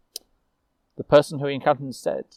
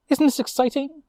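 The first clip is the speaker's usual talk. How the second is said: much higher, eager and bright; excited young witch; 13 semitones higher than usual. Acted. Young witch Lucille thrilled to her fellow knight, excited. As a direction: gush with delight. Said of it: "Lucille asked".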